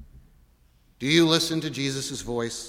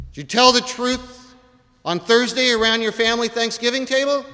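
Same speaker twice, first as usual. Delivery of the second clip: pressing question; 8 semitones higher, 6 dB louder than usual. Acted. Oath question pressed on congregation.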